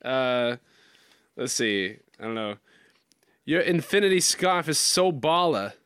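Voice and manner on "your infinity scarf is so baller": slow, higher put-on Boston; amateur Boston accent drifting into Walken. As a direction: draw out a mock Bostonian line. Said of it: "something to do in a Boston accent".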